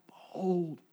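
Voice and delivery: quiet, reverent call to look; solemn behold. Shown as a demonstration. John invites the crowd to trust the lamb, quiet and reverent. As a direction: hush it, full of awe. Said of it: "He said".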